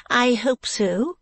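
high, slow reply; Madame Mantalini. Acted. Madame answering the visitor about the girl, high and slow.

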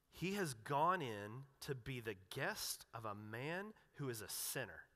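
grumbling crowd sneering at Jesus's host; disgusted grumble. Retold or imitated. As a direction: let the scorn drag out.

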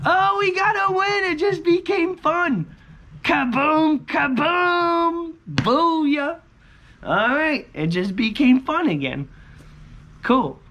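high, loud, slow shouts of joy; excited shouting. Real, not acted. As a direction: yell it out, thrilled. Sudden win after complaining.

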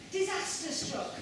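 ordinary delivery

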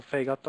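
"fegato" with the weight on FE, FE-ga-to; stress on first syllable. Shown as a demonstration. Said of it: "the first syllable".

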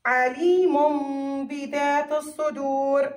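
عليم بذات الصدور slowly, with a held m before the ب; measured recitation of عليم بذات الصدور, lingering on the m; iqlab pronunciation. Shown as a demonstration. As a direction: stretch the tanween of عليم into a hummed m. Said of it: "بدلنا لميم".

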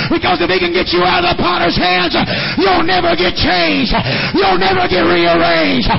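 loud, rising, slowed sermon climax; preaching at full force. Acted. Building altar call, loud.

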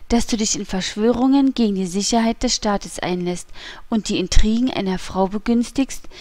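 plain reading voice

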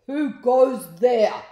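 slow, loud, high challenge; imperious storybook demand; demanding shout. A narrator's reading. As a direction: boom it out at intruders. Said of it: "demanded a voice".

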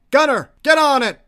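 loud, high combat command; shouted order. Acted. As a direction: shout an order to your gunner.

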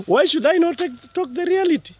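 very high-pitched indignant question; incredulous protest. Real, not acted. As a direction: demand it in a high, wailing pitch. Protesting at callers, after a laugh.

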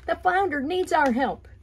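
high, loud alarmed voice; rally the others urgently; urgent shout. Acted. Bluefish urging everyone to help the flounder. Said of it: "said the bluefish".

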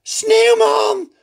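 very high, loud startled cry; frightened cry. A narrator's reading. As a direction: princess fears for the snowman. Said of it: "roept ze geschrokken". (geschrokken roep, heel hoog)

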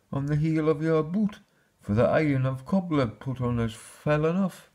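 high-pitched mother's explanation; storybook mother. A narrator's reading. Mother's speech voiced in story.

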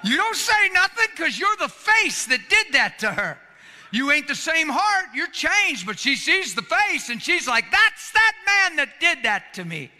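high, loud preaching cry; full-force preaching. Acted. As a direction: preach it out to the whole room.

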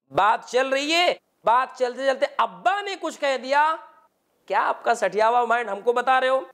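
ordinary talk, nothing marked

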